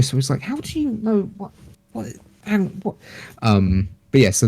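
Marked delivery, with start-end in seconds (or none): 0.4-3.0 high, soft, puzzled mock question; baffled. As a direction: sound lost and confused. Confused retold reaction.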